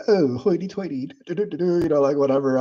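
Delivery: put-on half-hearted hoity-toity British accent; badly faked British, trailing into nonsense syllables; mock British accent. Streamer teasing Tasha about her accent, mock British accent. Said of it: "do you have a British accent".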